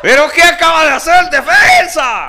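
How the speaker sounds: high, loud, laughing announcer; incredulous commentator. Retold or imitated. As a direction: call the blunder in disbelief. Imitating TV narrator after blunder.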